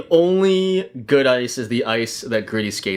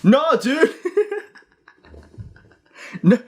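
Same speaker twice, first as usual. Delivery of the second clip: embarrassed guy; slower than usual. Pretend. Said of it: "he's just like".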